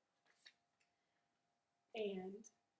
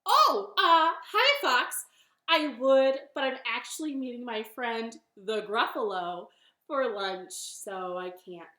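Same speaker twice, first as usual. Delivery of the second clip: nervous bunny; 3 semitones higher, 11 dB louder than usual. Acted. Bunny turns down fox's lunch, startled then settling. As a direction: stall loudly, then make the excuse. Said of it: "And the bunny said".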